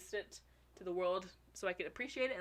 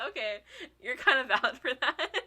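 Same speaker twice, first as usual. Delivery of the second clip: laughing; 5 semitones higher, 7 dB louder than usual. Retold or imitated. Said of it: "And I'm like".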